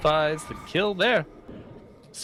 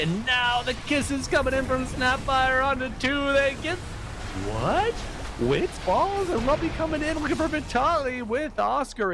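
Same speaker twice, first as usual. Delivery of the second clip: excited; 11 semitones higher than usual. Real, not acted. Caster calling a big teamfight.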